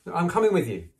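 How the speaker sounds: high, loud insistence; insistent. A narrator's reading. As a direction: announce it firmly, pitched high. Hermione insists on coming along.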